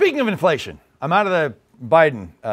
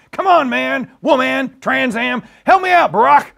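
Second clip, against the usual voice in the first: exasperated president calling out, higher and louder; Joe Biden; 5 semitones higher, 5 dB louder than usual. Acted. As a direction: plead like a flustered president. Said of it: "playing the part of Joe Biden".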